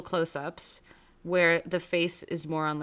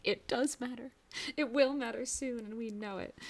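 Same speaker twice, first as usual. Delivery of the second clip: high, hushed insistence; mock protest; 5 semitones higher, 6 dB quieter than usual. Viewer talking back to the character, mock insistent. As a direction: argue back playfully in a small high voice.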